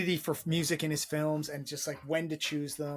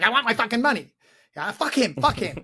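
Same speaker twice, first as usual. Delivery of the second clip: loud, higher-pitched imitated outburst; mock angry demand; 7 semitones higher, 9 dB louder than usual. Imitating the wig salesman hounding De Niro, mock angry. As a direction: pester loudly like an aggrieved wig salesman.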